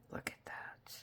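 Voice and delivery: hushed awed whisper. Real, not acted. Crafter marveling at the sparkle to viewers, whispered. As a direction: breathe it out in awe.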